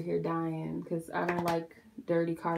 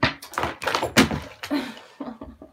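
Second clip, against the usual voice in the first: long breathy laugh, no voice; stunned laugh; 5 semitones higher than usual. Real, not acted. Laughs after spilling her drink.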